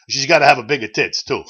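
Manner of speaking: loud punchline in a mock Italian accent. The Pope tells the doctor his last condition, loud, in an Italian accent. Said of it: "so I'll try to do the Italian accent".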